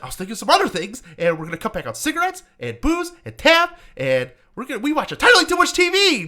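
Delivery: high, hammed-up cheery father; gleeful dad. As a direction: play the overexcited dad coming home. Voicing the gleeful dad character.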